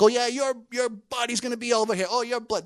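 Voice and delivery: hammed-up trash talk, high and loud; Goliath talking smack back. Goliath firing insults back at David, high and mocking. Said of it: "Goliath's going back".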